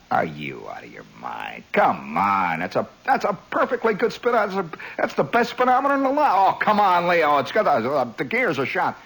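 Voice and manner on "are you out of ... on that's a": low, deep scoffing junk dealer; gruff junkman scoff. Retold or imitated. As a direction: sneer at the offer in a low rumble. Leo scoffs at lowball offer.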